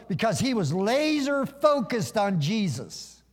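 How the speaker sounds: high-pitched, driving sermon delivery; impassioned preaching. Real, not acted. Preacher explains the storm around Peter, impassioned preaching. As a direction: build it up with emphatic key words.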